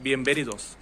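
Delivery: बिएनवेनिदोस said as biembenidos, n closed to m; n becomes m. Shown as a demonstration. Teacher shows the native Spanish way, n becomes m. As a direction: soften the n of बिएनवेनिदोस into m. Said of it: "बिएनवेनिदोस नहीं बोल रहा हूँ".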